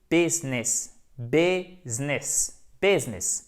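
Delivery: "business" as BÉZ-ness, repeated for learners; silent middle i. Shown as a demonstration. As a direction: say "business" in two syllables, first vowel near é. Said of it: "le i ne se prononce pas ici".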